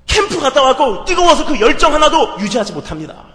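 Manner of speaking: loud, high, urgent sermon voice; full-force preacher's cry; preaching at full force. Acted. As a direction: drive it home to the congregation.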